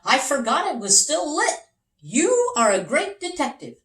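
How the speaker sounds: a touch of a hearty captain; lightly voiced captain. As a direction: play the amused ship captain.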